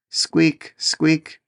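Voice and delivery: high, thin squeaking mouse voice; a small mouse squeaking in the dark; little mouse squeak. Acted. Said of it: "said a little mouse".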